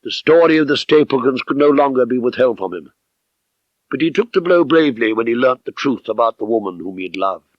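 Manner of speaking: ordinary delivery, nothing marked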